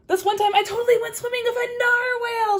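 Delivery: high, loud bragging; boastful bird. Acted. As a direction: brag breathlessly. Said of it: "she says".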